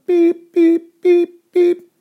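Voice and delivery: loud high electronic beep beep; alarm beeps. Retold or imitated. Alarm waking the dreamer, imitated high beeping.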